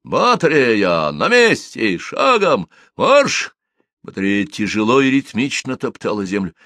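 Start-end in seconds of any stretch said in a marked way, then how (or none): 0.0-3.6 slow drawn command rising to a bark; shouting drill sergeant. Acted. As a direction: call it out, end on a sharp high марш. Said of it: "скомандовал".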